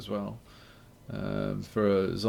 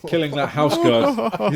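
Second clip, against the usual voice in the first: laughing; 8 semitones higher, 9 dB louder than usual. Acted. Laughing, still in role.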